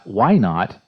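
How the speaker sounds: ordinary talk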